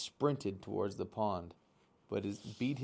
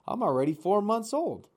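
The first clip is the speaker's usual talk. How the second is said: put-on indignant young gosling, higher; 10 semitones higher, 7 dB louder than usual. A narrator's reading. Gosling insists to mother.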